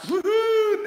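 high loud woohoo; excited cheer. Real, not acted. Host cheering her son after his talk, high and loud. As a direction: cheer him off the live. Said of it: "cheering son on".

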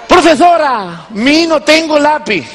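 put-on high, slow little boy pleading; childish mock kid voice. A boy tells his teacher he has no pencil, in a high, slow kid voice. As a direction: play the small boy talking to his teacher.

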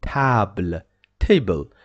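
table with a French a and no final e; French table, final e silent. Shown as a demonstration. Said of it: "we say table".

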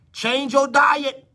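high, loud and slow, each word stretched; slow, drawn-out cry. Real, not acted. Preacher crying out to a woman to change her diet. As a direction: hammer it home slowly. Peak of repeated exhortation.

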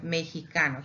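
"mexicano" said as me-hee-KA-no. Shown as a demonstration. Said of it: "mexicano with a J".

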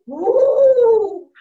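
big, loud ghostly woo; Papa Ghost wail. Retold or imitated. Papa Ghost haunts the house, loud wail. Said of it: "Papa Ghost would go".

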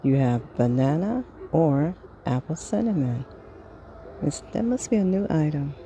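normal talking voice

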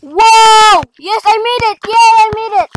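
thrilled cheer after finally landing it; excited cheer. Real, not acted. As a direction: celebrate making the jump. Gamer clears the jump.

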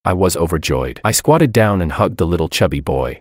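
plain reading voice

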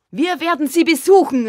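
high, loud, eager shout; calling out. A narrator's reading. Father announces a visit, loud eager call. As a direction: cry it out with gusto. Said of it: "rief der Vater".

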